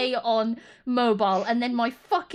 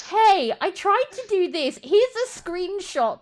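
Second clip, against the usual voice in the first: lightly higher, whiny user asking for help; mock user voice; 8 semitones higher, 3 dB louder than usual. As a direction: imitate a clueless user lightly. Retelling messages from users.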